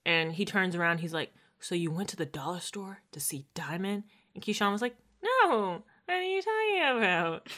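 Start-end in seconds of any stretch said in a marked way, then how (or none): 1.6-4.0 put-on hushed, menacing whisper of a suspicious boyfriend; imitated whisper. Voicing Derek's accusing question. 5.2-7.5 playing innocent. Voicing Keyshawn's denial.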